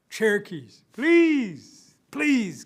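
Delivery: high, loud, drawn-out plea; forlorn begging. Acted. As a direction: beg pitifully, stretching each please. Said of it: "gets the most forlorn look".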